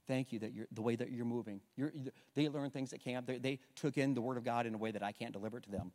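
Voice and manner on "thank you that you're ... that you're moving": hushed, soft thanks to God; barely above a murmur, grateful; quiet prayer. Retold or imitated. Pastor retelling his whispered thanks to God. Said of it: "I'm like".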